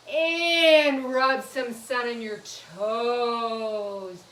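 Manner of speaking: very slow, loud and stretched; drawn-out instruction. Shown as a demonstration. Teacher repeats the sun-rubbing stretch for children, drawn-out. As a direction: hold AND and TOES long.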